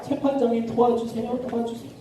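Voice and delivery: high, soft, repeated begging; persistent pleading. Acted. Widow returning daily to the judge, insistent soft plea. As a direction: beg over and over, fading away. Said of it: "하루가 멀다 하고 매일 찾아가는".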